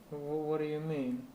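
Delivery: ordinary talk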